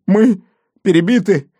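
high, loud cry of alarm; distraught report. A narrator's reading. Wounded gendarme reporting to his chief, distraught. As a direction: blurt out the disaster in a high voice.

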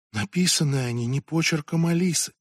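usual speaking voice

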